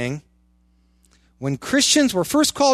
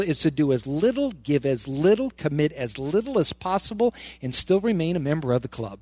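normal talking voice

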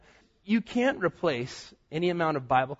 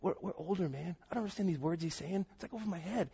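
hushed grumbling; 10 dB quieter than usual. Acted. Older members griping among themselves about the pastor, hushed grumbling. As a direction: grumble softly like a put-out churchgoer.

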